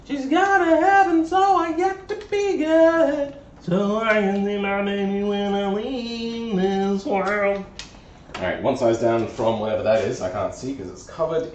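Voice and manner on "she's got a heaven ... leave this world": much higher, slow, sing-song character voice. Pretend. Mechanic to his wrench, in a high slow sing-song voice. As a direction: croon the line like a cartoon figure.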